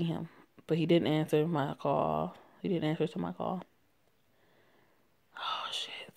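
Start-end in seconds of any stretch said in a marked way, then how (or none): 5.4-6.1 breathy unvoiced whisper of alarm; whispered oh shit. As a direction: hiss it under your breath, barely voiced. Quiet reaction in scary scene.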